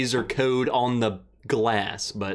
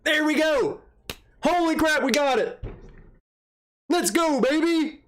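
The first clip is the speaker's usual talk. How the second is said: real thrill of hitting the goal; excited cheer; 13 semitones higher than usual. Real, not acted. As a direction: cheer like you just won the game. Hits Patreon goal live.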